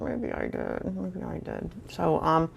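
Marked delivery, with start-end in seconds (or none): 0.0-1.0 much softer, quietly conceding; sheepish admission. Acted. Admitting to herself she was foolish too, softly conceding. 1.0-1.7 far softer, trailing almost to a whisper; near-whispered admission. Acted. Giving in to her own memory, a fading near-whisper.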